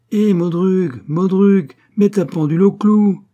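loud, high, relentless badgering; insistent pestering. A narrator's reading. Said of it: "il me persécuta, m'obséda".

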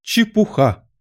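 high, firm exclamation; loud and decisive. A narrator's reading. Alice defies the raging Queen, loud and decisive. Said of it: "сказала Алиса очень громко и решительно".

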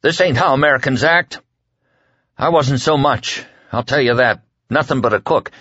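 desperate plea. A narrator's reading. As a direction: plead with rising panic.

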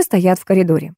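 plain reading voice

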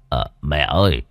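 put-on old son coaxing. A narrator's reading. Son at his mother's ear asks her inside, low coaxing. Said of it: "đoạn ghé tai cụ nói to".

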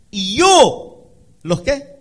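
high, loud burst; loud preaching cry. Acted. Preacher quoting God to congregation, loud high cry. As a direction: shout it out as God's own word.

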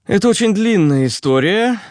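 high-pitched character voice; Руслан. A narrator's reading. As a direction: answer for someone else in a high voice. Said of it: "ответил за Актая Руслан". (высокий голос персонажа)

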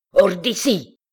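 father orders his daughter out in a rage; growled order. A narrator's reading. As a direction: throw her out with a roar. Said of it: "gronda-t-il".

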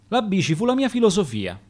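plain reading voice

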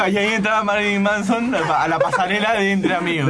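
ordinary talk, nothing marked

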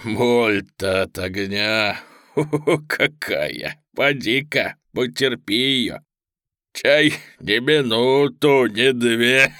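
gloating chuckles between slow, drawn-out words; mock-soothe the victim through laughter; sweetly laughing. Villain laughs at his hostage's terror, sweetly mocking. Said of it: "Пудовочкин сладко рассмеялся".

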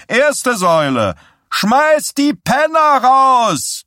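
a candidate preaching his program to a roaring crowd; rabble-rousing preacher. A narrator's reading. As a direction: whip the crowd up, stretching the punchline. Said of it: "aufpeitschende Sing-Sang-Stimme eines Erweckungspredigers".

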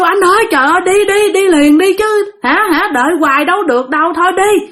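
high-pitched, frantic reenacted plea; retold outburst of panicked impatience, pitched well up; impatient urging. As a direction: urge a dawdling husband to go right now. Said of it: "Cái chị thấy lâu quá, chị kêu".

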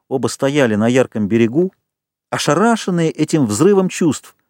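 usual speaking voice

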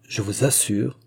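plain reading voice

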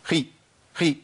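each خي very short and stopped abruptly; two brief, separate bursts of خي; cut-off sound. Shown as a demonstration. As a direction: chop خي off without letting the y flow. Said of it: "لو أنا قطعت الصوت".

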